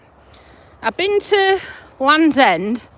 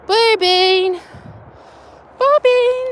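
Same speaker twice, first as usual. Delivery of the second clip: high-pitched singsong call; 9 semitones higher, slower than usual. Real, not acted. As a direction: call the name high to get attention. Calling out to Blue Bean.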